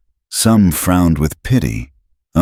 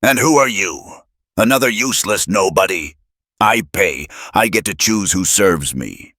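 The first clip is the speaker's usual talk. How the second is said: high, scornful sneer; 4 semitones higher than usual. A narrator's reading. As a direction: look down on him.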